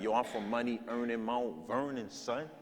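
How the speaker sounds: lightly voiced New York slang, drawn out; imitating cousins' New York talk. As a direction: mimic a cousin bragging New York style. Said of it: "they say stuff like".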